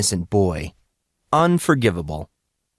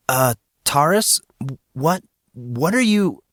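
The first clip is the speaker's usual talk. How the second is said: put-on flustered boy; 5 semitones higher than usual. A narrator's reading.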